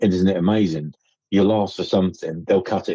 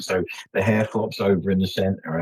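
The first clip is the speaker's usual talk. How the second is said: ordinary talk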